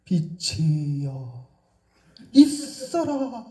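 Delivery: slow, grave voice of authority; authoritative voice. Shown as a demonstration. Preacher imitating God's commanding creation voice, slow and grave. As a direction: draw it out with weighty majesty. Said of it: "중후하고 멋지고 ... 권위 있는".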